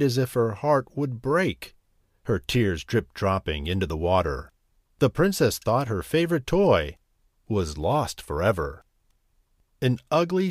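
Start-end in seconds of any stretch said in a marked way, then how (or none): none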